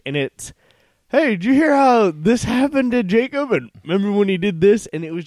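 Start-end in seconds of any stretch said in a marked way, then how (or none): 1.1-4.9 high, loud tipsy rambling friend; drunk friend. Retold or imitated. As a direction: play a loud drunk buddy telling stories. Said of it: "Joe's had too much to drink".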